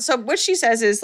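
ordinary talk, nothing marked

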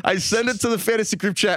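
reading through laughter; laughing. Real, not acted. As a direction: laugh while you read it. Rereads line laughing.

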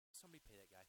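annoyed, dismissive aside, dropped low and soft; contempt. Acted. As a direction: wave it off with quiet contempt. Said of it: "I love the contempt in your voice".

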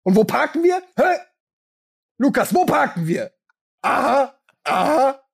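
high singsong taunting. Pretend. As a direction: tease a toddler. Taunting the small child.

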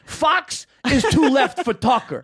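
high, slow, emphatic dad impression; dad's pronouncement. Son imitates dad judging Fox News, slow emphatic pronouncement. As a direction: declare it slowly like an outraged dad. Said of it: "He goes like this".

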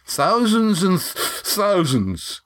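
put-on drunk, slow, words broken by a hiccup; tipsy hiccuping voice; around a hiccup. A narrator's reading. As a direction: hiccup through the word and restart it. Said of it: "said Sandy around a hiccup".

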